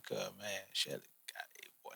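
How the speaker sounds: hushed, breathless retelling of being stunned; retold awe, voice falling away to almost nothing; awed hush. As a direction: drop to an amazed near-whisper. Retelling awed reaction to her singing.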